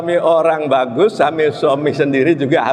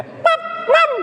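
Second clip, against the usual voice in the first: too high call. Shown as a demonstration. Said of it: "Kurang bagus".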